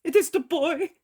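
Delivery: put-on high, tearful, broken old man's voice; Eli, sobbing plea. A narrator's reading. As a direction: grandfather weeps over his dying grandson. Eli breaks down weeping.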